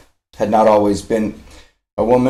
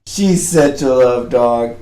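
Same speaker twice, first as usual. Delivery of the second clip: normal talking voice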